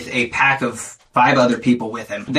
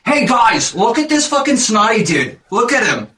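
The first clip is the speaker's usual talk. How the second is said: higher jock taunting the crowd; jock bully mockery; 7 semitones higher than usual. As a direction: show him off to your buddies with a sneer. Jock bully mocks to friends.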